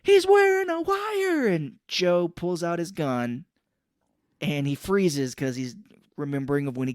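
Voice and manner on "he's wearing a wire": much higher, louder, slow yell; hammed-up alarmed shout; mock alarm shout. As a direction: shout the warning across the room.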